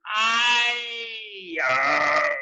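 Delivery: high, loud roaring cry; giant's pained roar. A narrator's reading. Giant roaring in pain while asleep. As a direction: howl out in a nightmare. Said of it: "roared the flesh lump eater".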